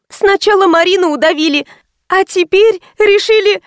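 put-on high-pitched, alarmed girl's voice; frightened accusation. A narrator's reading. Анжела accusing her supposed killer, high and frightened. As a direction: voice up high, scared of the visitor.

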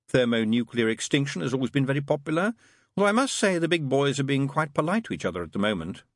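lightly voiced demon musing aloud; demon character, chatty. Demon speculating on the world's end, lightly voiced and chatty.

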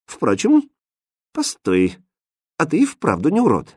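hesitant, halting reconsideration; uncertain. A narrator's reading. Princess takes a second look at him, uncertain. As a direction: pause between thoughts as doubt creeps in. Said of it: "неуверенно проговорила".

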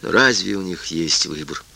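ordinary delivery, nothing marked

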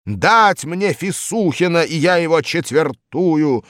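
high, loud slow bellow; loud gloating threat; triumphant roar. A narrator's reading. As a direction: roar as if you've caught him. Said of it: "Стёкла в окнах дрогнули от рёва".

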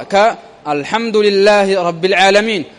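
normal talking voice

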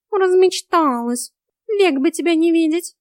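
high, loud cat voice; high-pitched, loud rebuff; scornful cat. A narrator's reading. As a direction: snub her as a haughty cat.

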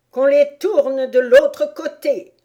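loud, high, slow royal command; barked order. A narrator's reading. Queen has gardeners turned over, loud order. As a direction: order them about imperiously. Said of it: "ordonna-t-elle".

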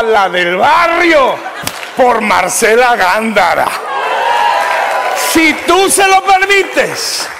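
loud, soaring pulpit preaching, words stretched; preaching at full force. Acted. Pastor preaching to the congregation, at full force. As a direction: preach it out to the whole church.

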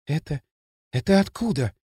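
halting astonishment; amazed, forced out. A narrator's reading. Машка noticing Дрын, haltingly astonished. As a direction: stammer it out in mild amazement. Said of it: "изумлённо выдавила Машка".

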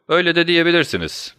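high-pitched, breezy agreement; cheerful. A narrator's reading. Detective answers being called a thief, cheerful. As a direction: cheerfully shrug off the insult, pitch lifted. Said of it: "dedi Holmes neşeli bir şekilde".

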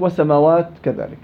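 ordinary talk

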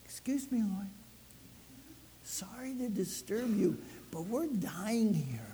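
a mild, overpolite whisper to not disturb; polite timid aside. Pretend. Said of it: "They didn't go".

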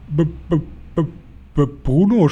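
high, halting stammer on the first sound. A narrator's reading. Dumbfounded father seeing his mouse son, stammering. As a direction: stick on the B before getting the name out. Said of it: "stammelte Mister Jenkins".

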